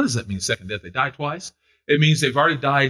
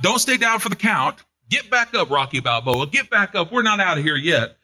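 rousing preaching; 5 semitones higher than usual. Acted. Preacher rallying the church, rousing exhortation. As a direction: urge them back to their feet.

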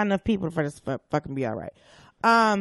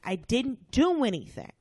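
ordinary delivery

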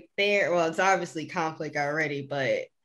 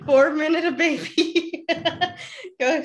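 laughing; 9 semitones higher than usual. Real, not acted. Repeats the joke, laughing.

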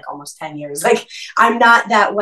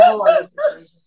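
laughing. Real, not acted. High squeal while laughing.

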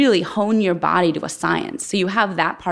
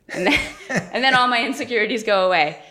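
laughing; 5 semitones higher, faster than usual. Real, not acted. Laughs at her vodka joke.

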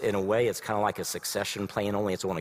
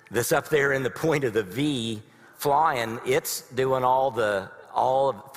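slow, lightly honking goose voice; 4 semitones higher, slower than usual. Acted. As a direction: play a clumsy goose leading the V. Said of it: "I make a terrible goose impression".

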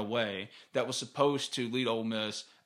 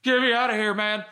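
much higher and louder panicked cry; freaking out; 9 semitones higher, 6 dB louder than usual. Retold or imitated. Claustrophobic teen on plane, panicked higher louder cry. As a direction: plead to get off the plane in a panic. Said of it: "Just freaking out".

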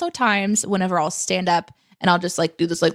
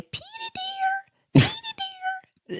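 screeching great-aunt; 4 dB quieter than usual. Retold or imitated. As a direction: imitate her horrible screeching call, stretched way out. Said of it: "screeching, horrible noise".

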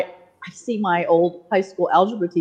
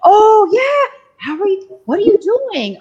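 much higher, bright recognition voice; delighted old teacher; slower than usual. Retold or imitated. Old algebra teacher greeting his former student, high and delighted. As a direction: play the teacher suddenly remembering her, pitched way up.

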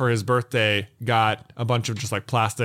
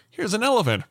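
higher, chipper smart-speaker announcement; lightly mocked Google voice; imitated voice assistant; 9 semitones higher, faster than usual. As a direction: present it like a helpful assistant. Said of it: "it'll be like".

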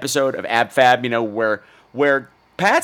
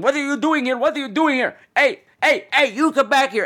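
aggressive stranger; 12 semitones higher than usual. Retold or imitated. Imitating the man who grabbed her, high mock yell. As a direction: bark it like a threatening stranger. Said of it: "He's like".

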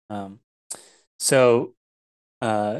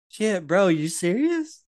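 raised dude-bro disbelief; mock dude-bro Vanini; 8 semitones higher, slower than usual. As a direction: play him like a surprised bro. Vanini voiced again.

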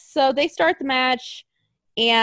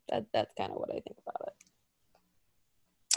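muttered aside; 12 semitones lower, 13 dB quieter, slower than usual. Real, not acted. Laughing aside, breathy.

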